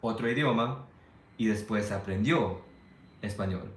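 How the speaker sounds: conversational Spanish as a learned language; speaking Spanish. Shown as a demonstration. Showing listeners how their Spanish sounds, speaking learner's Spanish. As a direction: own second-language Spanish. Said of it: "Like, that's my Spanish".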